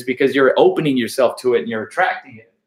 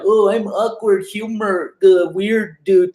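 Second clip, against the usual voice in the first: mocking impression; 6 semitones higher, slower than usual. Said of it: "they try to play like".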